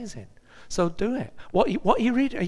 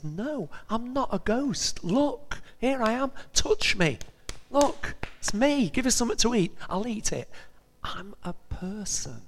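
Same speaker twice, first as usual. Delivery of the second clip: voicing Jesus; 7 semitones higher than usual. Jesus to frightened disciples, higher reassuring voice. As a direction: coax them like a friend proving he's real.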